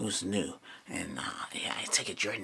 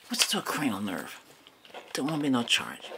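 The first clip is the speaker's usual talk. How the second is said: ordinary talk, nothing marked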